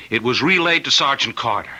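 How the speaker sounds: usual speaking voice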